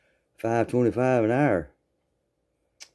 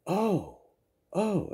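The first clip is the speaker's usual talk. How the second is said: impressed oh; 10 semitones higher, 3 dB quieter than usual. Retold or imitated. Girls react to money.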